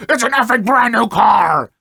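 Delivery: loud, high furious yell; imitating a raging dad shouting; retold angry shout. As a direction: rage over his brand new car. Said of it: "He started yelling things like".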